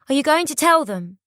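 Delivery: high, loud frightened question. A narrator's reading. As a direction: blurt it out in fear. Flora frightened.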